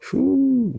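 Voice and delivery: long, high-pitched "whew"; awed whew. Real, not acted. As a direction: let out a high, drawn-out whew. Awed reaction while preaching.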